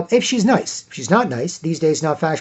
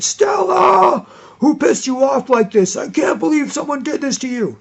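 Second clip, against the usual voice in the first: furious protective outburst; 11 semitones higher than usual. Retold or imitated. Boyfriend raging at whoever upset Stella, in a high shout. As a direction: roar it out, outraged on her behalf. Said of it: "I'm so furious, I'm going".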